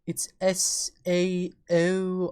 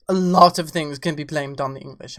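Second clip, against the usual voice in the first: put-on posh English accent, lower and louder; 5 semitones lower, 5 dB louder than usual. Shown as a demonstration. Streamer to chat, in a mock English accent. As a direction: say it like a stuffy Englishman. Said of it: "I say with an English accent".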